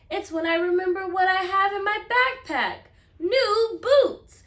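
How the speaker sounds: high, loud rush of realization; excited realization. Acted. Jeremy remembering his new boots, high excited burst.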